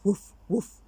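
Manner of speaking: playful woof woof; dog bark. Acted. Dog skeleton barks.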